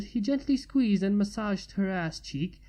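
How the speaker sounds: ordinary delivery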